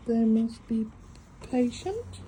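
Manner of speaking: ordinary delivery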